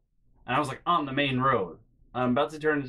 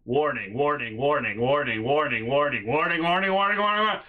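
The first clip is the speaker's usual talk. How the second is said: escalating GPS alarm; 2 semitones higher, 5 dB louder than usual. Retold or imitated. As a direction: chant it like a GPS getting frantic. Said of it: "it's like".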